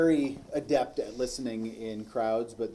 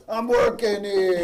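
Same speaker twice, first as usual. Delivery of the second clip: slow, loud, higher Boston accent; 7 semitones higher, 6 dB louder, slower than usual. Shown as a demonstration. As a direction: ham up a Northeast accent. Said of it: "Only in their accent".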